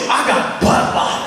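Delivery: put-on slow, drawn-out kid line, half laughing; kid's slow deadpan. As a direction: say it slowly, stifling a laugh. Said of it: "He goes".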